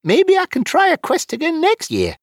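high-pitched hopeful plea. Acted. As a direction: ask in a high, hopeful character voice. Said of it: "Wizfiddle said hopefully".

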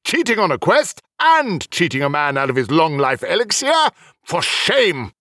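high-pitched indignant reproach; shaming a cheat; disgusted scolding. Acted. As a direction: scold him in disgust, building to a verdict. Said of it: "Heliok shook his head disgustedly".

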